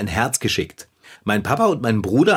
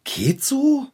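incredulous echo; 13 semitones higher than usual. Acted. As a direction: pitch shooting up in disbelief. Stung echo of lukewarm verdict.

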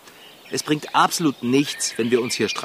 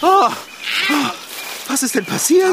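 startled; 9 semitones higher than usual. Acted. Waking up confused in game.

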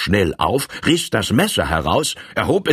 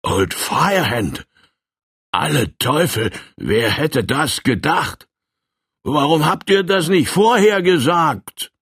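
shocked exclamation; 8 semitones higher than usual. Acted. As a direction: recoil at hearing the name. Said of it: "hielt den Schritt an und rief".

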